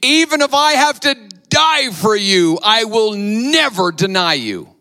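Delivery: high, loud, brash vow; boastful Peter. Retold or imitated. Peter boldly vowing loyalty to Jesus, high and loud. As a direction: Peter insisting he'd die first. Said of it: "But then Peter goes on, being Peter".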